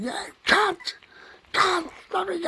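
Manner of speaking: high, strained shouting cry; shrill desperate street yell; retold yelling. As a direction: yell it out like someone being dragged off. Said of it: "somebody was going".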